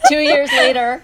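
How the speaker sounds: high laughing burst of amusement. Real, not acted. Joking about joining two years late, laughing. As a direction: laugh the words out, delighted.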